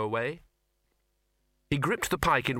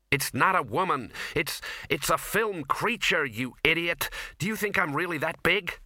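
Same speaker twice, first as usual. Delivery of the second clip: higher-pitched, moderately raised protest; indignant shout; 5 semitones higher than usual. A narrator's reading. Ginger correcting the dean, high indignant protest. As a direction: set an idiot straight, indignantly. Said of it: "shouted Ginger".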